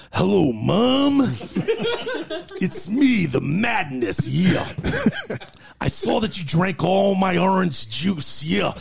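high, slow gravelly-free wrestler promo voice, drawn out; Macho Man impression. Prank caller mocking Macho Man's mom, in his voice. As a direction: ham up the Macho Man, stretching every word. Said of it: "I'm gonna call in Macho Man's voice".

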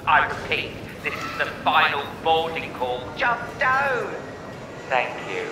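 formal boarding call over a PA; airport announcement. Pretend. Podcast host mock boarding call.

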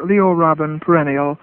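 ordinary talk, nothing marked

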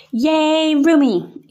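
high, loud cheer; cheerleader yell. A narrator's reading. Roommate cheering like a cheerleader, high and loud. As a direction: cheer it out like a pep squad. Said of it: "in her best cheerleader yell".

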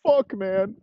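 high, strained put-on voice for Teddy. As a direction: play Teddy high and pleading. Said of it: "fucking Teddy's like".

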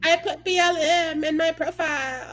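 hammed-up performative ally.

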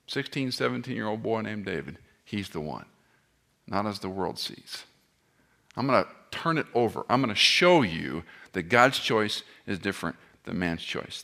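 normal talking voice

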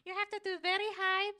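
loud, very high cartoon squeak; Mort. Player imitating a cartoon sidekick calling the king, squeaky. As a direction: draw the name out in a squeal. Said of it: "King Julian".

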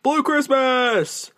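high, slow, drawn-out excited announcement. Real, not acted. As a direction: announce it with delight, stretched out. Announcing her favorite song.

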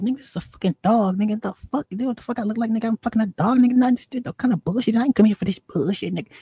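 high-pitched mock outrage, words tumbling out; spazzing out. Outraged woman refusing the dealer's demand, spazzing out. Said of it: "she starts spazzing".

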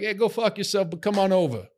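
high-pitched New Jersey Italian-American; friendly Italian accent. Retold or imitated. New Jersey local cussing a visitor in welcome, friendly Italian-American accent. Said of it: "They're so friendly, too. Everyone was like".

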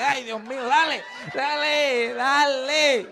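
high, drawn-out moaning dale; hammed-up woman urging him on; mock moaning partner. Partner urging him on mid-sex, mock moaning.

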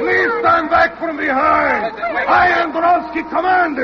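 put-on shouted command in a Russian accent. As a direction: order the crowd back. Manager clearing a crowd.

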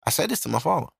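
usual speaking voice

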